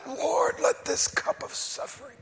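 strained high voice, almost unvoiced; anguished high cry. Prayer in garden quoted.

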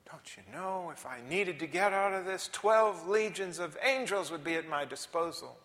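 preacher quoting Jesus with force; insistent preaching. Acted. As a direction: press the rhetorical question.

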